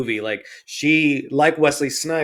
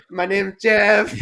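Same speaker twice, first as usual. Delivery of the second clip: mock Gambit accent; 5 semitones higher, slower than usual. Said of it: "every time he spoke, all I could think of was".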